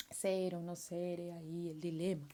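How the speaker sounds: Hamlet recitation. Retold or imitated. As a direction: muse it darkly as the prince. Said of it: "el de Príncipe Hamlet".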